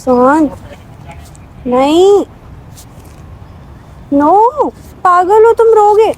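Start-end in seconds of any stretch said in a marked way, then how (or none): 5.0-6.1 higher, louder, playful scolding; teasing disbelief. Real, not acted. Girlfriend teasing crying Sohan, high playful scolding. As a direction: tease him out of his tears.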